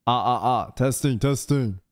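normal talking voice

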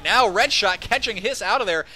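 loud, quick play call; excited call. Real, not acted. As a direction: bark out the pick. Sudden pick in live cast.

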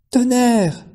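high anguished shout; terrible cry. A narrator's reading. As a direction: wail his name in terror. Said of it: "cria Capucine d'une voix terrible".

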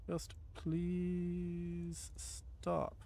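very slow, drawn-out quiet plea; weary plea. Real, not acted. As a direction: stretch it out, begging wearily. Begging zombies to stop.

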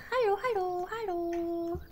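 high, squeaky hello hello in a cartoonish sing-song; mock anime girl voice. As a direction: play a cutesy anime sister greeting everyone. Repeats the mock greeting.

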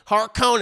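Harkonnen with a long OH, har-KOH-nen; family pronunciation. Shown as a demonstration. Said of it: "That's how my mommy and daddy have always said it".

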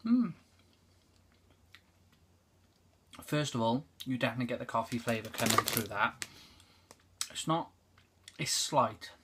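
speaking between bites; chewing while talking. Real, not acted. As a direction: mouth partly full of biscuit. Seen 0: Bites and chews biscuit between and during phrases; biscuit in hand.

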